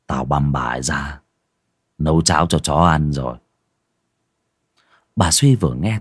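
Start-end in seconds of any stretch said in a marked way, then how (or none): 0.1-3.4 low, sunken voice. Acted. Husband coldly confessing the killing to his wife, low and sunken. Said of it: "Giọng ông bây giờ trùng xuống".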